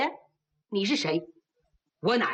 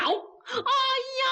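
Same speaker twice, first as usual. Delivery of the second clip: furious cry; 13 semitones higher than usual. Acted. 暴怒大叫.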